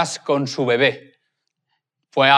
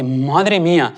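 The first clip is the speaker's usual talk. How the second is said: a first hushed madre mía; stunned, held back; much slower than usual. Retold or imitated. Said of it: "El hombre flipa y dice".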